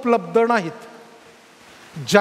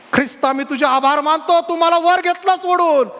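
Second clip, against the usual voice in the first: rising cry of praise, higher and louder; exultant thanks; 7 semitones higher, 4 dB louder than usual. Acted. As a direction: build into a jubilant shout of thanks. Imagined cry of thanks to Christ.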